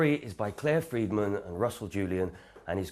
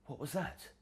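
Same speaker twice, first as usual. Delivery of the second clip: soft, higher scared question; hushed fright; 6 semitones higher, 8 dB quieter than usual. Acted. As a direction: ask it nervously under the breath.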